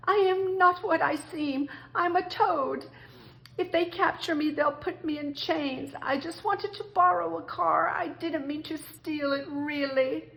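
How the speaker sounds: high, panicky confession; frightened pleading. Acted. Terrified Toad confesses to the engine driver, high and pleading. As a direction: Toad owns up in a frantic high voice.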